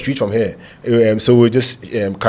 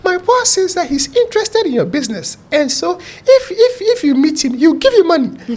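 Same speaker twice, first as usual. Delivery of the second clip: put-on much higher, tiny voice of the young lady. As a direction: keep the tiny high voice going. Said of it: "with a very tiny voice".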